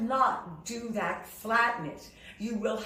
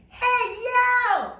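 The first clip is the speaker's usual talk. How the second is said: high, loud holler across the street; open-mouthed long-distance shout; schoolyard yell; 13 semitones higher, 8 dB louder than usual. Shown as a demonstration. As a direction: call out like a kid in a schoolyard. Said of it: "yell across the street".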